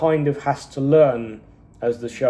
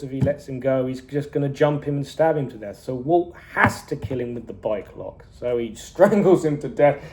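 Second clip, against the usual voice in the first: ordinary talk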